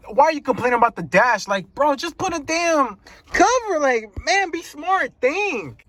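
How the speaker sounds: high-pitched, pleading exasperation; exasperated. Retold or imitated. Driver to owners complaining about a cracked dash, exasperated. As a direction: scold them as if it were obvious.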